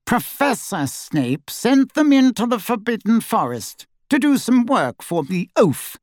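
high, lofty explanation; snide grandee, disdainful. A narrator's reading. Snide portrait Phineas explains punishment.

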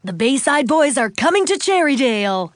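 high, loud DJ hype; radio DJ announcement. A narrator's reading. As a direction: announce big news to the fans on air. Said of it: "the D J said".

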